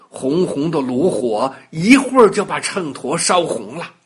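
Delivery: ordinary delivery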